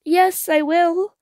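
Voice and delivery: high, slow, trembling yes; fearful but determined girl. Acted. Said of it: "in a trembling voice".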